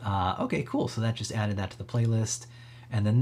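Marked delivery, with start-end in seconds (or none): none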